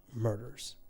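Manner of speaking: normal talking voice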